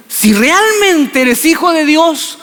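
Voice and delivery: high mocking devil voice; devil taunting. The devil doubting Jesus, high and mocking. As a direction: needle him with a sly high whine. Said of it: "Y le dice".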